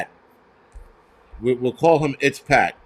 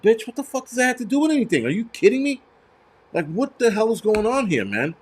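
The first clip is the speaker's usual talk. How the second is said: high-pitched incredulous outburst; raised pitch, can't believe this nonsense; exasperated disbelief; 7 semitones higher than usual. Retold or imitated. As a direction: fire it back, baffled and fed up. Acted exasperated reply to retold quote.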